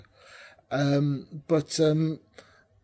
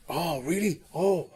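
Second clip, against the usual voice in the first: mock Welsh accent, lightly done; hammed-up Welsh accent, slightly higher; bad Welsh accent; 3 semitones higher than usual. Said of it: "bad Welsh accent".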